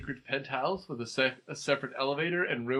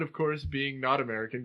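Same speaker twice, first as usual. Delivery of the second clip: ordinary delivery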